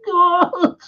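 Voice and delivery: high mock cry of alarm; retold shocked exclamation. Retelling the walk-in at the freezer, mock alarm. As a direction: yelp it high, acting out the film.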